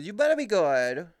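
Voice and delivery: jokingly warning a friend; mock threat. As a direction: tease with fake menace.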